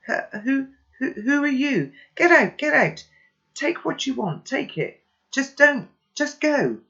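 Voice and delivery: high, loud panicked stammer; frightened girl pleading with an intruder; terrified and shaking. Acted. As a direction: beg the intruder to leave in terror. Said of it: "her voice is shaking".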